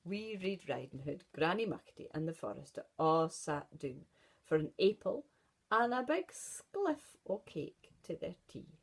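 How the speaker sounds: plain reading voice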